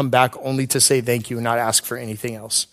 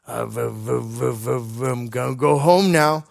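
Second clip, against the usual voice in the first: imitating George's halting speech; 3 semitones lower than usual. George stuttering out a goodbye.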